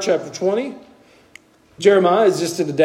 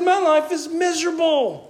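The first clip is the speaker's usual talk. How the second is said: much higher, plaintive complaining voice; despairing prophet; 11 semitones higher than usual. Retold or imitated. Preacher voicing Jeremiah lamenting his birth, high and plaintive. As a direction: whine it out to the heavens. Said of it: "He said".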